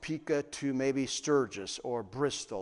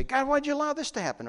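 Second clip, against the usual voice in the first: quick, high-pitched mock protest; complaint to God; 10 semitones higher, faster than usual. Believers murmuring to God, quick high complaint. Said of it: "when we murmur and complain".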